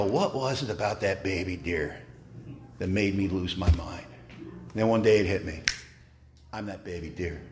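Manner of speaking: low, hushed and reflective; soft, deep-dropped confession; quiet realization. Retold or imitated. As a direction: let it sink in, very softly. Sober realization, story's turn.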